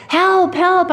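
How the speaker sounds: high-pitched mock cry for help; retold yell. Stranded driver yelling to a distant car, mock yell. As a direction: call for help, high but not full force. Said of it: "I yelled".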